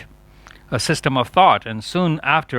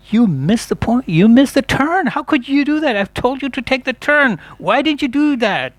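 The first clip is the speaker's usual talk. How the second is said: nagging spouse; 11 semitones higher, 3 dB louder than usual. Retold or imitated. Nagging spouse at missed turn.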